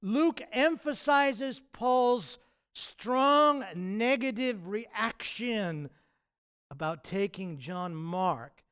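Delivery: drawn out, high and loud on Paul's strong; slow right down and lean on strong; preacher spelling out the point slowly; slow emphasis. Shown as a demonstration. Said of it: "what I'm saying is this".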